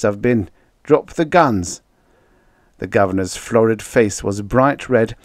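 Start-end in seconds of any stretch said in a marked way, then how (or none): 0.8-1.8 strangled, high, slow words; choking. A narrator's reading. Governor with the arm round his neck, choking. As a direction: force it out as if throttled. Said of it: "choking, strangling sounds".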